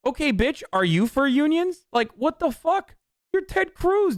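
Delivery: high, disbelieving outburst; incredulous. Real, not acted. Ranting at Ted Cruz's hypocrisy, high incredulous outburst. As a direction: pitch shoots up in disbelief.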